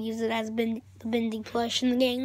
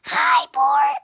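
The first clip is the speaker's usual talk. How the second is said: attempted cartoon character voice, louder; Bendy; 3 semitones higher, 7 dB louder than usual. Vlogger attempting a character voice for viewers, Bendy voice. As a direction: put on a loud, cheery character greeting. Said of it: "I can't do my Bendy voice".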